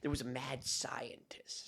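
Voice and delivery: hushed storyteller whisper. Acted. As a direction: whisper it like a spooky tale.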